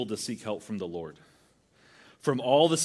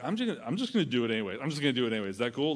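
rushed excuse; much faster than usual. Retold or imitated. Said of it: "you're like".

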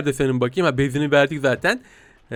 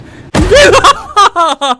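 high shrieking laugh burst; 14 semitones higher, 9 dB louder than usual. Real, not acted. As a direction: cackle high and loud. Prank laugh.

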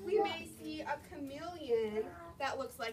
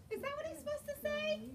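ordinary delivery

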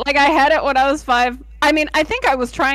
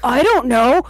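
earnest determined anime hero; 5 dB louder, slower than usual. Pretend. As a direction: shout the command like a young trainer. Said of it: "Random anime protagonist voice".

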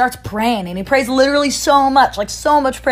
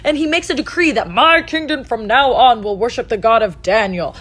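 ordinary talk

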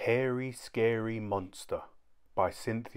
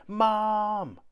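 high, loud call for Mum; child's yell; 11 semitones higher, 5 dB louder than usual. A narrator's reading. Kipper yelling for Mum, high and loud. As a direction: shout for Mum across the house. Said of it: "yelled Kipper".

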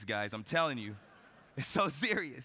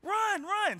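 high, loud cheering shout; a crowd of kids screaming him on, much higher; teammates yelling; 12 semitones higher, 3 dB louder, much slower than usual. Retold or imitated. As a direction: yell him round the bases. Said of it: "my teammates are like".

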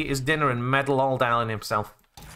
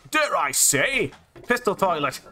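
exaggerated British accent, higher and drawn out; uber British; 4 semitones higher than usual. Gamer hamming up his pistol toilet line, uber British. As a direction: go hammily, over-the-top British. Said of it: "had to go uber British there".

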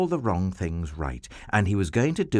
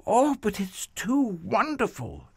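much higher, breathless with wonder; amazed Abraham; 13 semitones higher than usual. Acted. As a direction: gasp it out like he can't believe it. Abraham amazed at God's promise.

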